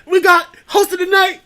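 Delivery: mock introduction of Shaniqua to a crowd; hype host. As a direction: belt the name out like a club MC. Hype host announcing a name.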